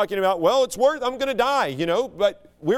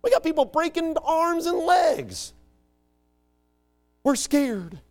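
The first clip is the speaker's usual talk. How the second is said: upset coach; 12 semitones higher than usual. Retold or imitated. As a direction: rail at them like a fed-up football coach. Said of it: "he was all upset".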